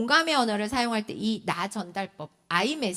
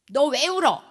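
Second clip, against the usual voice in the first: mock parental scolding; 5 semitones higher, 6 dB louder than usual. Quoting parents' scolding words.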